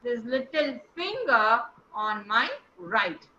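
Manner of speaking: "finger" ve "right" vurgulu, cümle iki düşünce grubunda; stress on finger and right. Shown as a demonstration. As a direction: split after "finger", hit "finger" and "right". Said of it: "So finger and right will take the stress".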